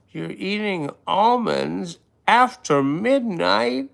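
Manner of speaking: loud, high cry of horrified disbelief; hammed-up high-pitched shriek of protest; mock outrage. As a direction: wail it, scandalized and over the top. Mock outrage at eating almonds.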